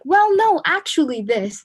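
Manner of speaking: high, loud know-it-all cut-in; mocking someone talking over others; mock interrupting. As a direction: talk over them, dismissive and shrill-free but high. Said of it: "start speaking over them and be like".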